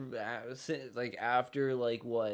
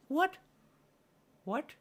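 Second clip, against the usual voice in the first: normal talking voice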